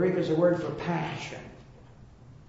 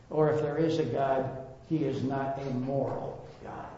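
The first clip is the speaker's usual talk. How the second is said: slow, hushed and weighty, with long pauses; dramatic retelling; 3 semitones lower, 4 dB quieter than usual. Retold or imitated. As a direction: draw out the grim story softly, word by word. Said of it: "he dramatically tells the story".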